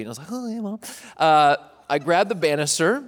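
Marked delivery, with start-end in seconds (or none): 0.3-0.8 put-on sweet kid. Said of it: "I was super sweet".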